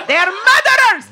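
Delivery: high, hammed-up Greek-accented rant; bad Greek accent. Comic repeating the driver's catchphrase, high bad Greek accent. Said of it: "I can't do the accent".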